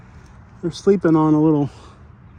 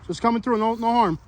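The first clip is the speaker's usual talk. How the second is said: reassuring call; 6 semitones higher than usual. Real, not acted. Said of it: "always good to announce yourself".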